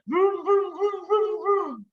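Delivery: high, playful engine noise; engine vroom. Acted. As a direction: rev it like a toy truck. Imitating truck engine.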